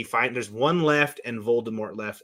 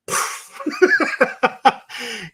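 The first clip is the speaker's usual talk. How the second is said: long high-pitched laugh; high, emotional fit of laughter; overwhelmed laugh; 13 semitones higher, 5 dB louder than usual. Real, not acted. Moved by Harry's sacrifice.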